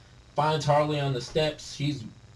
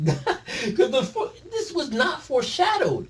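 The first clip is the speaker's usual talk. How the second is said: laughing, much higher incredulous; slower than usual. Real, not acted. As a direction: laugh through the disbelief.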